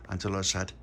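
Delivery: usual speaking voice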